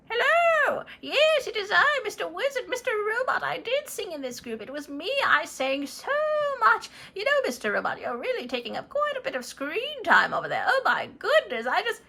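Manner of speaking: high, excited wizard calling in; Mister Wizard on transmission. Acted. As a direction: burst in high and eager over the line. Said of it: "I am receiving a transmission".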